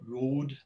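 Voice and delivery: ROAD with a long steady O, no glide; traditional Welsh English. Shown as a demonstration. Linguist demonstrating the Welsh accent to an audience, ROAD with a monophthong. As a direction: say ROAD the Welsh way, "rohd" on one pure vowel. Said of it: "a simple long monophthong".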